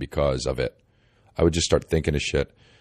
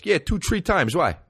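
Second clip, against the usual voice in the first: put-on high, casual New York accent; 11 semitones higher than usual. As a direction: answer like a proud New York local. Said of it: "the other guy says".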